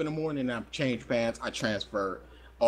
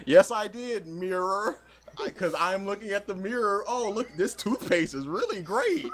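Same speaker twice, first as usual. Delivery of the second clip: high nervous voice; 7 semitones higher than usual. Retold or imitated. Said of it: "I'm like".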